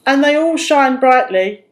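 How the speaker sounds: jolly shepherd. A narrator's reading. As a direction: tease her kindly. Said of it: "he chuckled".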